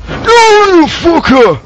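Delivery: high loud startled curse; startled shout. Real, not acted. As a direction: yell in shock. Startled by monster.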